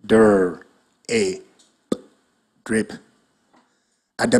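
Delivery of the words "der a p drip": segment "drip" as "Der, a, p", then blend; sounding out words. Shown as a demonstration.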